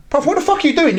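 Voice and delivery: very high-pitched incredulous challenge; mock outrage without shouting; retold disbelief. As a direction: act out calling someone out, pitch shot up. Retold Irish man confronting the fighter.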